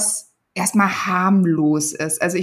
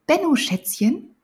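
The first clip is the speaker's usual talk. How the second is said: sweet motherly coaxing, higher; 7 semitones higher, slower than usual. Acted. Mother telling Benno he may ride on the roof, coaxing. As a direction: coax your son gently. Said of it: "sagte sie".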